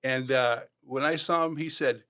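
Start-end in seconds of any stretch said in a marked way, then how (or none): none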